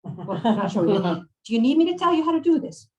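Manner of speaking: put-on high, loud, quick Italian-accented nagging; exasperated put-on Italian accent; Maria, Italian accent. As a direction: offer impatiently as an old Italian woman. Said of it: "I'm going to butcher an Italian accent".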